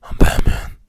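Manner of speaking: loud, raspy gravel Batman growl. Retold or imitated. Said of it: "that's kind of serious to it".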